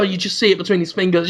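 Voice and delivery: usual speaking voice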